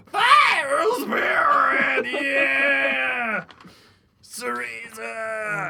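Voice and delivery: high, slow anguished screams; screaming crowd. Acted.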